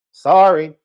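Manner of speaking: loud mock apology; not sorry at all; sarcastic sorry. Real, not acted. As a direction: fake apology with a loud snap. Sarcastic brag about subscribers.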